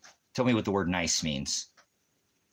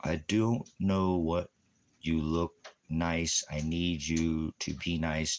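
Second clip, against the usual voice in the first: mock slow drawl; slower than usual. Mocking a slow speaker.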